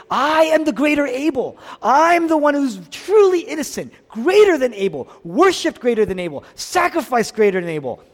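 high, loud preacher's declaration; ringing voiced declaration from the pulpit; impassioned proclamation. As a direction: proclaim it forcefully in Jesus's place. Preacher voicing Jesus's declaration.

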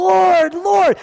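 high, loud drawn-out plea; crying out. Acted. Worshippers calling on their Lord, crying out. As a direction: cry it out with stretched, emotional force. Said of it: "They're crying out to him".

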